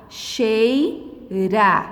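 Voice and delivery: "Cheira" split into slow separate syllables, chei, ra; pausadamente. Shown as a demonstration. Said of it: "Fale pausadamente a palavra". ("Cheira" pausado, sílaba por sílaba)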